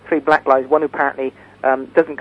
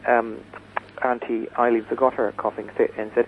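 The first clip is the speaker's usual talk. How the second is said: ordinary talk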